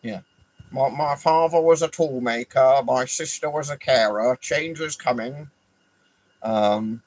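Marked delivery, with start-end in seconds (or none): 0.7-5.5 a lightly put-on earnest politician's voice; mock politician speech. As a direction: parody a solemn campaign line.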